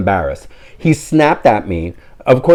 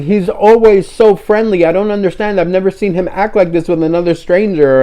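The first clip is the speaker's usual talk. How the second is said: apologetic owner; 9 semitones higher than usual. Retold or imitated. Owner insists her dog is always friendly, higher flustered. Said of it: "she kept saying".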